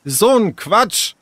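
high, drawn-out dismissal; angry. Acted. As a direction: dismiss it crossly, stretching the words. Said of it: "wütend warf Sarah die Haare". (wütend und gedehnt)

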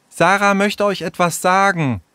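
high, expectant announcement; prompting. Acted. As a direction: announce it to nudge her along. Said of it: "Auffordernd zupfte Jule Sarah". (auffordernd, mit hoher Stimme)

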